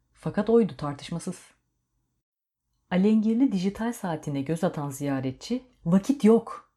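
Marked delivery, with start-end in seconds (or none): 5.9-6.7 loud, higher urgent shout. A narrator's reading. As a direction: bark it out in a panic about time. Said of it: "diye bağırdı".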